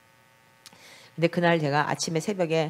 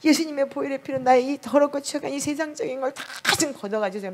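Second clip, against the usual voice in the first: high, hushed pleading prayer, retold as if weeping; 7 semitones higher than usual. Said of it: "두 시간을 통곡을 하면서 눈물로". (높고 조용하게 울먹이는 회개 기도)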